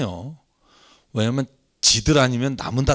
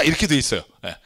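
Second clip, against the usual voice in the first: ordinary talk, nothing marked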